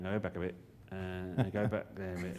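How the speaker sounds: a soft, slow, mumbling editor; lightly voiced bored editor, soft and slow; quiet, slow editor. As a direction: murmur the notes slowly. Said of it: "a bloke going".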